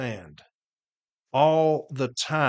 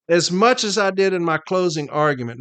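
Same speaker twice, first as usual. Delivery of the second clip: usual speaking voice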